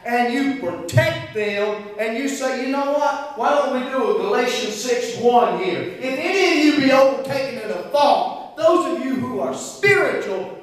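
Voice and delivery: high, loud, stretched-out pulpit cry; full-force preaching. Real, not acted. Preacher urging church to cover sinners, full-force preaching. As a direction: drive it home with soaring volume.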